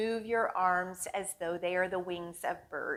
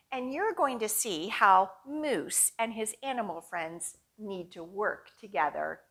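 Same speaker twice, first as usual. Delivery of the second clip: usual speaking voice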